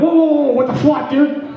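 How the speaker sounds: high-pitched startled protest; alarmed protest. Acted. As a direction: cry out in alarm at the pepper spray. Reacting to pepper spray being drawn.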